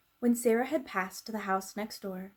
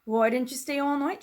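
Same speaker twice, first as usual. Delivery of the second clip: higher, louder gruff cook voice snapping; louder irritable snap; cook's snap; 5 semitones higher, 5 dB louder than usual. Acted. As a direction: snap at the girl as a bad-tempered cook. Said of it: "she snapped".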